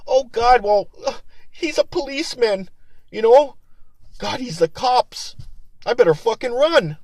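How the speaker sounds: high, mocking scared-citizen voice; mock panic. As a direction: imitate people in awe and fear of a cop. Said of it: "The right away they think".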